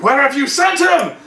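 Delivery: high, loud hammy demand; mock demand. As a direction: bark it like a furious villain. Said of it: "he's like".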